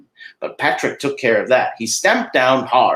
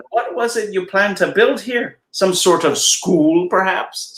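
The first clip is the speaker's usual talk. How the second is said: a lightly voiced, huffy little leprechaun, rising higher; indignant leprechaun; 8 semitones higher, slower than usual. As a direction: scold the intruder as a proud old leprechaun. Patrick questions Mickey.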